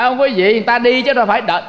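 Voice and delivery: usual speaking voice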